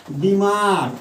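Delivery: बीमार with the first syllable बी pressed; stress on बी. Shown as a demonstration. Teacher showing the class the stressed बी in बीमार. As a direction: lean on बी in बीमार. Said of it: "बी पर हम ज़्यादा जोर डालते हैं".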